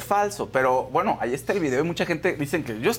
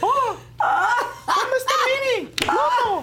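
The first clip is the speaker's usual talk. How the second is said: voz de Mickey Mouse; slower than usual. Pretend. Sigue con voz de Mickey.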